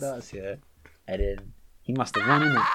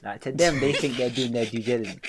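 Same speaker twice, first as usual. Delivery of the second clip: usual speaking voice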